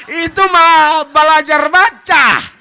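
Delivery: loud, slow mocking jab; loud slow mock scorn; scoffing shout. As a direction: scoff at spelling it out. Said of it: "mocking letter reading".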